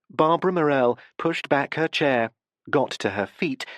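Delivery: ordinary delivery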